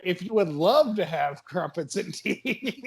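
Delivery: slow mock posh crumpets line; lightly mimicking a friend's British voice; imitating British accent. Teasing a friend by copying her mock British tea offer. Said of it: "I like how you're like".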